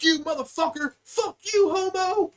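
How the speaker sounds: hammed-up insults for a pretend brawl; fake arguing. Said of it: "got into a fake fight".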